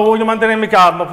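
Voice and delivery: ordinary talk, nothing marked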